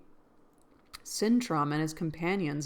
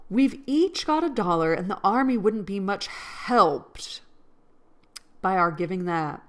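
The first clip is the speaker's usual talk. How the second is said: mock Mid-Atlantic accent; 2 semitones higher, 6 dB louder than usual. Shown as a demonstration. Rereading Meg's line to viewers, hammed-up Mid-Atlantic accent. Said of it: "North Atlantic accent where it's, like, kind of British".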